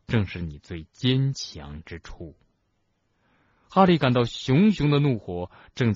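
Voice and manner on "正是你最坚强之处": slow, low, measured reassurance; calm, unhurried sincerity. A narrator's reading. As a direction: say it gently and deliberately, pitched low. Dumbledore gently reassures upset Harry.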